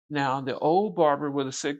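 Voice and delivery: ordinary talk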